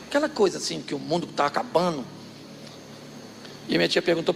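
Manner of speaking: usual speaking voice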